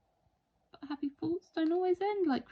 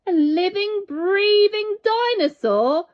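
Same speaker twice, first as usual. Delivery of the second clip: much louder, slow with awe; wonder; 9 semitones higher, 10 dB louder than usual. A narrator's reading. Narrator marvelling at what the boy sees. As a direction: marvel at each word.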